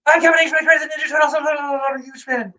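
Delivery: high, excited fan greeting; gushing at his comedy hero; starstruck fan gush. Retold or imitated. Excited fan gushing at Terry Jones. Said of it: "I just jumped up and I go".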